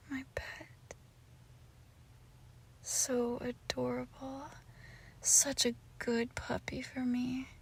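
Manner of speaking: very soft, half-whispered endearments; tender murmuring. Acted. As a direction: murmur adoringly to your pet, barely voiced. Murmured endearments while cuddling pet.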